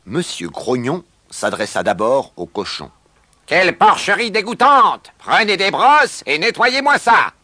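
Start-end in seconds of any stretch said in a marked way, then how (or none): 3.5-7.3 loud, high-pitched outraged order; angry scolding. A narrator's reading. New owner berates the pig about its sty, angry scolding. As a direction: tear into them at full voice.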